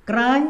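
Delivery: क्राई repeated with a rising tune; pronunciation demo. Shown as a demonstration. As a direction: echo क्राई rising, as if checking. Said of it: "तो इसको क्या पढ़ेंगे?". (क्राई दोहराते हुए, उठती tone)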